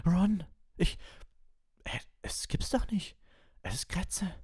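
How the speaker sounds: put-on high, breathless girl's voice in shock; startled girl. A narrator's reading. Hermione finds the rat in the jug, stunned. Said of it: "stieß einen spitzen Schrei aus".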